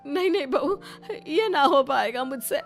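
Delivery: high, panicky plea through tears; tearful, fearful refusal. Acted. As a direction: shrink from the promise, crying.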